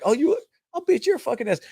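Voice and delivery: high, mocking echo of a threat, hammed up; mocking retort. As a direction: throw his threat back at him in a sneering squeal. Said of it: "And he's like".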